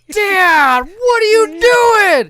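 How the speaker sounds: imitating a daughter's high, loud shriek; shocked daughter. Daughter shrieks at dad after his prank, shocked. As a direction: wail it in mock horror, drawn out.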